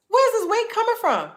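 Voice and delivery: high, loud incredulous question; loud, high-pitched disbelief; baffled outcry. Retold or imitated. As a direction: exclaim it in exaggerated bewilderment. Baffled by her weight gain.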